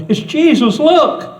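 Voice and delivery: high, loud thrilled shout; excited child. Retold or imitated. Little girl calling out to her grandparents, high excited shout. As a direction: point and cry out like a three-year-old. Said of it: "screaming his name".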